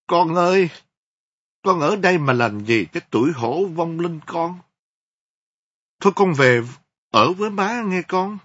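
high, loud wailing cry to her dead son; tearful lament. Acted. Grieving mother speaks to her dead son's photo, wailing in tears. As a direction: call out to the photo through tears. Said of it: "nói trong nước mắt".

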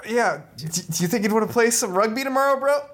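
high, hopeful ask; asking someone out. Acted. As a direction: pitch it up, hoping for a yes. Asks again, voice up high.

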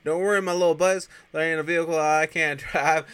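high, loud, goofy bravado; mock cocky reassurance. Ernest reassuring the screaming elves, hammed-up bravado. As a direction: play an overconfident driver over the din.

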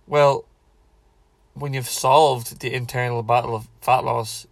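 ordinary delivery

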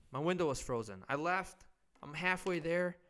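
ordinary talk, nothing marked

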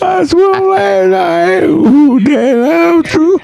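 squeaky high, slow laughing defense; high laughing plea; laughing high protest. Real, not acted. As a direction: protest while giggling.

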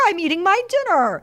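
high, indignant mock complaint; stubborn protest. Refuses to leave her dinner.

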